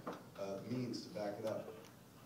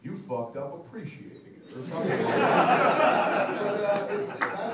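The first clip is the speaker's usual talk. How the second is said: breaking into high laughter; words swallowed by laughter; laughing; 8 semitones higher, 14 dB louder, slower than usual. Real, not acted. Telling the crowd about bad critics, laughing loudly.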